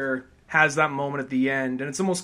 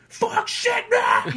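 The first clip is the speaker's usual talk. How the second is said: sudden high yelled curse; shouted high, played for laughs; mock outburst; 6 dB louder, much slower than usual. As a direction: blurt it out like a caveman. Acted abrupt punchline outburst.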